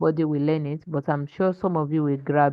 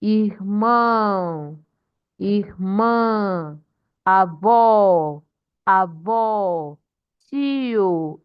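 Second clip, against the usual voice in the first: slow word list, each stressed vowel held long; stressed syllables; 2 semitones higher, 3 dB louder, much slower than usual. Shown as a demonstration. Teacher demonstrating stress to class, drawn-out 'Irmão, irmã, avó, tio'. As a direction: stretch the accented part of every word. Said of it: "that is why we pronounce".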